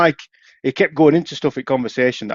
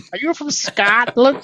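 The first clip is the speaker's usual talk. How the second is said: put-on much higher, slow American accent; 13 semitones higher, slower than usual. Caller asking where he's from, American accent. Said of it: "imitate them ... American accent".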